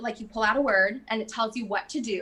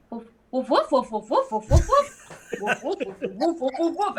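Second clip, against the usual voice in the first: dog barking; 2 semitones higher, 3 dB louder than usual. Retold or imitated. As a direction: bark down the phone like a silly dog. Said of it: "their phone calls would be like".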